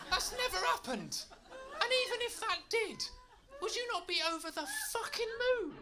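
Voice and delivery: high, loud laughing glee; overjoyed at finding a seal at home; giddy excited rant. Acted. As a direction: gush with delight, almost shouting.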